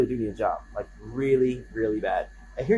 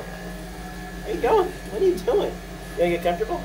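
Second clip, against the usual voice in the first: high pet-talk voice; 10 semitones higher than usual. Real, not acted. Asking the wandering cat in high pet talk. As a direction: coo up high at the animal.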